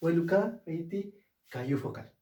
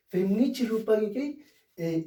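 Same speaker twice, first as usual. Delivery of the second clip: normal talking voice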